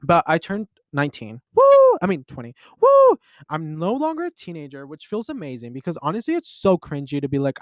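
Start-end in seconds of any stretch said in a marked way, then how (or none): 1.5-2.0 very high, loud celebratory whoop; excited whoop. Real, not acted. Birthday girl cheering her own twentieth birthday, loud high whoop. 2.8-3.2 very high, loud celebratory whoop; excited whoop. Real, not acted. Birthday girl cheering her own twentieth birthday, loud high whoop.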